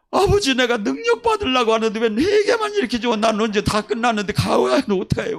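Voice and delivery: a desperate, tearful-ish appeal; pleading lament. Retold or imitated. Pastor crying out to Father while repenting, pleading.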